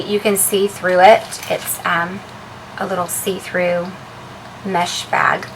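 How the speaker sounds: ordinary delivery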